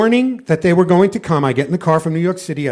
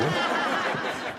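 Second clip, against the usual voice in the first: breathy laugh; 11 dB quieter than usual. Real, not acted. Laugh after screaming at deer.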